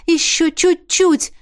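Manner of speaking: high, drawn-out encouragement; slow, high-pitched cheering on; urging on. A narrator's reading. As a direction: coax them the last little bit. Urging climbers on from above.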